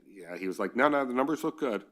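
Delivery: usual speaking voice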